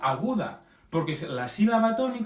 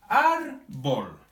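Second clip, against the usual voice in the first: "árbol" split in two, weight on "ár"; teacher's demonstration of "árbol" with stressed first syllable; 3 dB louder than usual. Shown as a demonstration. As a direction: lean on "ár", then let "bol" fall. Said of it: "tiene la sílaba tónica en la penúltima sílaba".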